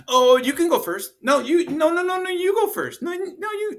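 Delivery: put-on children offering each other the first turn; polite kids. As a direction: sweetly insist the other goes first. Kids politely insisting on going second.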